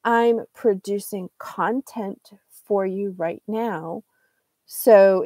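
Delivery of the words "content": content as CON-tent within a sentence; stress on first syllable. Shown as a demonstration. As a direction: put the weight on CON in content, full vowel. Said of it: "if you highlight the first syllable".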